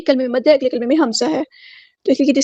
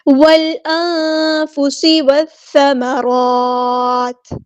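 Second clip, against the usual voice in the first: slow, drawn-out melodic tajweed recitation; Quran recitation; much slower than usual. Shown as a demonstration. Teacher recites the verse for students, slow melodic chant. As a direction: chant each word slowly in tajweed.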